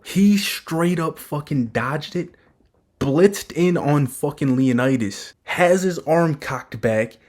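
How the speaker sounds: slow, emphatic excited play-by-play; hyped up. Real, not acted. Reviewer hyping a favourite panel's dodge, excited and emphatic. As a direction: punch out each beat, drawn out and thrilled. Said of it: "Maybe I'm hyping this shit".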